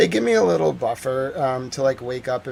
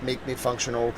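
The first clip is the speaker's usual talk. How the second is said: ordinary delivery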